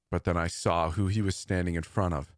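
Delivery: ordinary delivery, nothing marked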